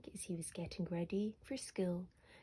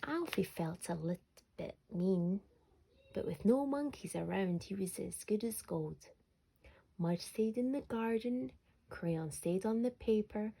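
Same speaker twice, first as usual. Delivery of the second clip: usual speaking voice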